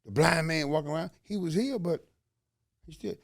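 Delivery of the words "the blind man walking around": usual speaking voice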